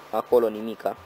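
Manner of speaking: ordinary delivery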